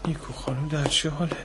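hushed, tender whisper; soft whisper. Acted. Nurse whispering to a sleeping little girl, hushed. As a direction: whisper gently over a sleeping child.

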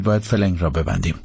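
ordinary delivery

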